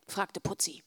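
plain reading voice

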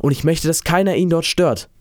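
put-on a boy's restrained call. A narrator's reading. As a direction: call across to the fishermen without shouting. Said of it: "rief er den Fischern zu".

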